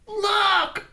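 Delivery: high excited call; cried out. A narrator's reading. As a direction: point and call out. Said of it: "cried the ram".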